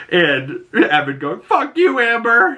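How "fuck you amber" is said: high, louder mock-angry outburst; pissed off. Evan to his girlfriend during questioning, high pissed-off yelp. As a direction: imitate a boyfriend yelping in outrage. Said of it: "he's all pissed off".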